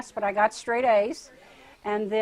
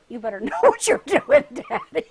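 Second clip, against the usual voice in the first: louder, higher, laughing through the warning; laughing retort; 7 semitones higher, 9 dB louder than usual. Retold or imitated. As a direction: tease God with a laugh. Telling God he better know.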